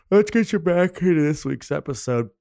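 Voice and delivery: yawning through the words; fake yawn. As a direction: talk mid-yawn. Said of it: "that was a fake yawn".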